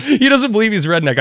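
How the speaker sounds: ordinary talk, nothing marked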